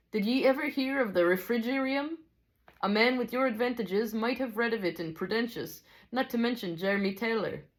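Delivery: high Scottish character voice; Scottish teacher, high and lilting; attempted Scottish accent. A narrator's reading. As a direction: prompt them in amateur Scots, pitched high. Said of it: "I'm not good at a Scottish accent".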